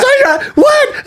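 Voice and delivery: very high, stretched mimicked shriek; shrieked outburst. Girlfriend erupting in the restaurant, imitated shriek. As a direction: screech it like an outraged girlfriend.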